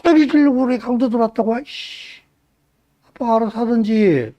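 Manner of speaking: put-on high, whiny irritated teenager; annoyed daughter. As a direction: grumble like a sulky kid woken up. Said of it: "예람이가 일어나서 이러는 거야".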